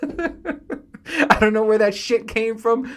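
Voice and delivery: amused after the name story; laughing. Real, not acted. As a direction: talk through real laughter.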